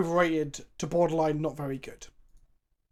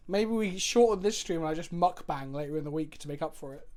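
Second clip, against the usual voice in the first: ordinary talk, nothing marked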